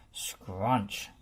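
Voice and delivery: plain reading voice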